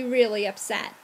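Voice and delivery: ordinary delivery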